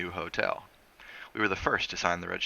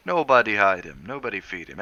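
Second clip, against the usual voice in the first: loud, emphatic island driver; firm insistence; 2 semitones higher, 8 dB louder than usual. Acted. Driver insisting nobody would hide him, loud. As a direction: insist it hard.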